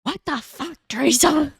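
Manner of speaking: high-pitched, strained retort; mock exasperation. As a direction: imitate a husband at his wits' end. Husband's exasperated comeback, acted out.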